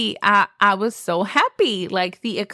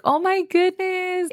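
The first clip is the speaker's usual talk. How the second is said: high, hushed exclamation of awe; awed surprise; 10 semitones higher than usual. Retold or imitated. Bride seeing her engagement ring, high awed exclamation. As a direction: marvel at the ring, voice lifted high. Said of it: "I was like".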